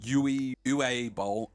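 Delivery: Uwe said slowly, tried out twice; trying out the name. Shown as a demonstration. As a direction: test Uwe slowly before Boll. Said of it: "however you say his name".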